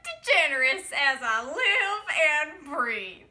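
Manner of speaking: slow, high, loud, drawn-out Southern belle greeting; put-on Southern drawl. Fan greeting Ellen DeGeneres in a hammed-up Southern accent. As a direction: gush her name in a thick mock Southern accent. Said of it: "I don't know why I talk like that. That's not my accent".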